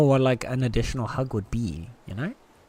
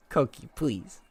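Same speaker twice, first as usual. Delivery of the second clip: usual speaking voice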